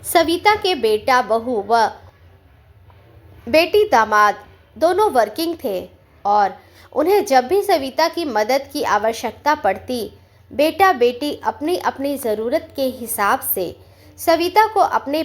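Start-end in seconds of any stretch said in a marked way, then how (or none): none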